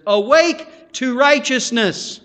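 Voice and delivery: loud, high, slow call to wake; Paul's charge proclaimed to the church; urgent command. Acted.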